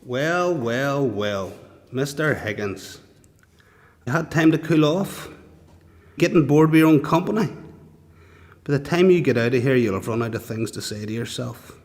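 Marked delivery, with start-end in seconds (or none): 4.1-9.8 raised, louder goading; taunting officer. Acted. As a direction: needle the prisoner, pitched up. Officer taunts prisoner after solitary.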